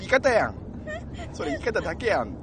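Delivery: loud, high comic comeback; exasperated retort. Retold or imitated. Student's inner reaction to the bento seller, exasperated retort. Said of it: "と思ってカルチャーショックを受けました".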